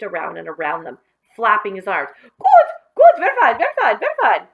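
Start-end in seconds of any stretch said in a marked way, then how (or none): 2.2-4.5 much higher, much louder birdlike cry; crazed crying out. Acted. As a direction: squawk it like a mad bird, hopping. Billy hopping, flapping like bird.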